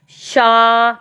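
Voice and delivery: शा held long on the aa; sounded-out syllable. Shown as a demonstration. Teacher breaking छात्र for children, शा drawn out. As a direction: stretch शा slowly so both its sounds can be heard. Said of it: "पहले बच्चे को आवाज आ रही है श".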